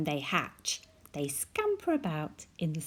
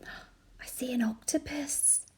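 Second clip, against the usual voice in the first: gasped whisper; 7 semitones higher than usual. Acted. Gasping discovery.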